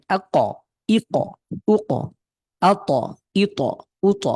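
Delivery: আ ক, ই ক, উ ক with the k punched and released; doubled consonant with a short echo vowel, as in আ ক and আ ত; echoing bounce. Shown as a demonstration. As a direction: push each stop and let it rebound in আ ত, ই ত, উ ত. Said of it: "একটু প্রতিধ্বনি করে".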